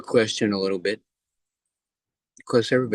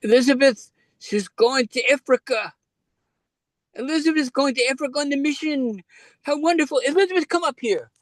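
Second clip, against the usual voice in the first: put-on sing-song Kiwi accent, pitched way up like an excited preacher. Mega church preacher hailing Elizabeth from the stage, in a high Kiwi accent. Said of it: "They had this Kiwi accent".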